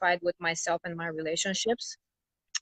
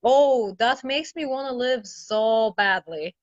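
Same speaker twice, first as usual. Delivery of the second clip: higher, drawn-out sarcastic retort; exaggerated incredulous comeback, dripping with irony; mock disbelief; 3 semitones higher, 3 dB louder, slower than usual. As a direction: act out scoffing at the parents' lecture. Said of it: "my whole life I've just been".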